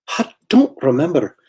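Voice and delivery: Scotty impersonation. Acted. Artist quoting Scotty from Star Trek, Scottish impersonation. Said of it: "Scotty from Star Trek".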